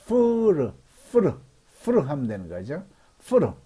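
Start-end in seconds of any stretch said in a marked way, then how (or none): 0.1-0.7 푸르 opened with an English F, high and drawn out; teacher's exaggerated F in 푸르; careful F sound. Shown as a demonstration. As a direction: stretch 푸르 out from a careful F. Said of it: "F 발음을 조심하면".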